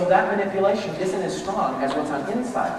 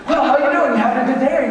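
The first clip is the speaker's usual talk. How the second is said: high, loud, peppy hello; cheery greeting; 8 semitones higher, 5 dB louder than usual. Pretend. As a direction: greet them all pumped up. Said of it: "The happy group goes".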